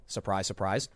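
flat, sarcastic "Surprise, surprise", low and soft; deadpan sarcasm. Real, not acted. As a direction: mutter "Surprise, surprise" low under the breath. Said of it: "dry aside on predictable news".

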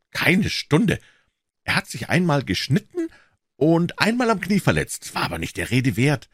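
put-on high, eager young man's voice; Offerton chiming in. A narrator's reading. Offerton interrupting Holmes with a suggestion, high and eager.

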